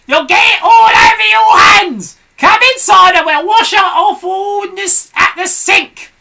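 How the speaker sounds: very high, loud hag scolding; Mrs Twit screeching. A narrator's reading. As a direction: screech orders at her husband. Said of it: "cried Missus Twit".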